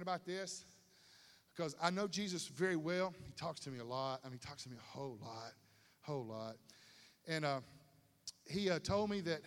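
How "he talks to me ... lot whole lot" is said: hushed aside. Real, not acted. Intimate aside about Jesus.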